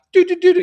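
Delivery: high, loud dialling tones; imitated dialling. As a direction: mimic keys being punched in a hurry.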